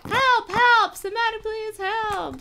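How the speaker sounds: very high, loud cries for help; screaming for help. Acted. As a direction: scream at the top of your lungs. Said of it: "Screaming at the top of their lungs".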